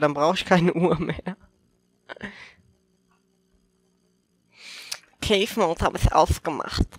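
slushy lisp on the s sounds. Real, not acted. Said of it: "hör auf zu lispeln".

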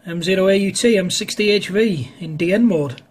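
ordinary talk

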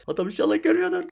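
tense high-pitched hope the enemy doesn't see; squeaky high plea. Real, not acted. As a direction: squeak it out like a nervous prayer. Hoping the enemy misses him.

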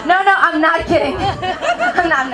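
usual speaking voice

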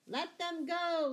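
high, slow, loud call; amused call. A narrator's reading. Robin letting the sheriff's men flee, amused call. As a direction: call it out, lightly triumphant. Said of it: "laughed Robin".

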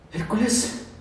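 plain reading voice

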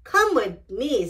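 plain reading voice

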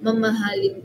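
ordinary talk, nothing marked

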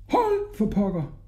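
high, slow, held-back cry; mock scream. A narrator's reading. Hodja yelling after the runaway carpet, mildly screamed. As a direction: cry out high without real volume. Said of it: "sprang han op og skreg".

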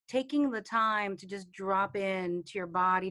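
usual speaking voice

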